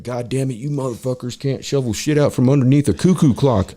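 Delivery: acted-out irate boss; ranting boss. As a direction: berate the crew in exasperation. Prideful boss berating his crew.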